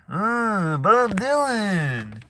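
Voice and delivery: high, drawn-out singer impression. Vlogger mimicking a famous Minnesotan singer, high drawled impression. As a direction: stretch it high and slow like the folk singer.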